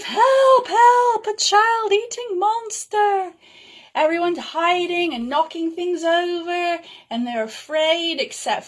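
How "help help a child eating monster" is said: high, loud screaming shopper; panicked cry for help. Acted. Shopper screaming about a monster in the store, high and loud. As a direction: shriek for help, terrified of the monster.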